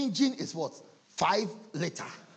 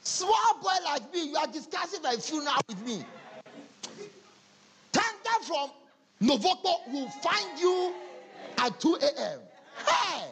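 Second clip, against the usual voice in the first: indignant outburst; 8 semitones higher than usual. Retold or imitated. Indignant retort, shouting and laughing.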